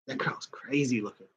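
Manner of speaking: soft voiceless aside; hushed aside about the actress; whisper. Real, not acted. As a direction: whisper it like a secret.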